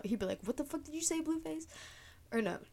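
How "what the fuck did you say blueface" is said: higher, mock-tough snarl; imitating angry boyfriend. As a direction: play the offended boyfriend, pitched up. Boyfriend confronts [name] in dream.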